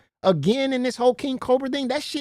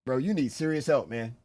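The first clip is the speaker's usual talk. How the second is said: ordinary delivery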